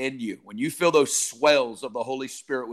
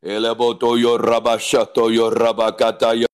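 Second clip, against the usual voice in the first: loud tongues; 2 semitones lower, 7 dB louder than usual. Real, not acted. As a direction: cry the syllables out to God.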